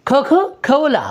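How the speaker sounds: Coca-Cola as "kho-kho khola"; aspirated K. Shown as a demonstration. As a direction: puff air after each k in Coca-Cola. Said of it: "ख की आवाज़ जो है यहाँ".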